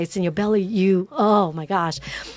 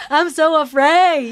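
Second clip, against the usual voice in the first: high, loud and slow, playing afraid; mock fear; 8 semitones higher, 7 dB louder than usual. A biblical hero confessing fear, high and slow. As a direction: ham up the fear. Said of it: "You know".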